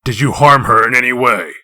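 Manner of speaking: loud, pressing demand; hard questioning. Acted. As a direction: keep up the pressure. Interrogation continues.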